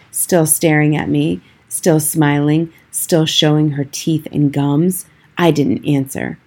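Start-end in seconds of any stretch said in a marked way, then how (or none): none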